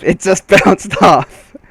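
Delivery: slow, giggling through the words; breaking into a laugh mid-line. Real, not acted. Narrator laughing at Steve's knife bouncing off.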